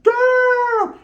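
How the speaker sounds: high, loud comic damn; shocked cry. Retold or imitated. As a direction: blurt it out in disgust. Reacts to dirty apartment.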